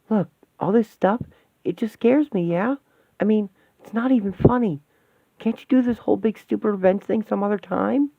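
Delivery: plead, frightened and upset; scared pleading. Acted.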